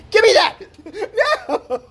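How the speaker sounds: loud, high-pitched hammed-up yell; mock snatching shout. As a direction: shout as if grabbing it away. Acting out snatching someone's Frosty away.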